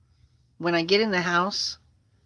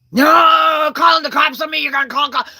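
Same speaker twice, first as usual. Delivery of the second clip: imitating a high, loud wailing protest; shouted protest; 8 semitones higher, 8 dB louder than usual. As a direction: cry out in mock alarm. Acted panic at police threat.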